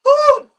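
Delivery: a loud, high exhale of exhaustion; high whew. Real, not acted. A mum worn out from solo parenting, loud high whew. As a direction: blow out a big weary whew.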